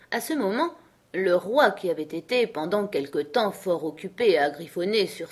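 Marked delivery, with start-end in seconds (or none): none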